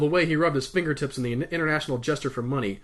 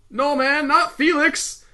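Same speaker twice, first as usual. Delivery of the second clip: high, loud protest; exclaiming; 11 semitones higher, 5 dB louder, slower than usual. A narrator's reading. Kid correcting Perry about his name, exclaiming. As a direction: cry out the objection. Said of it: "he exclaimed".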